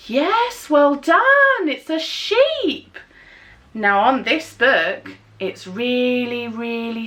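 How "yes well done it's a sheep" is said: excited praise. Real, not acted. Praising child's answer.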